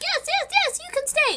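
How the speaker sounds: squeaky-high eager yeses; hammed-up high dwarf chorus; eager dwarves. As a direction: gush it like thrilled little men.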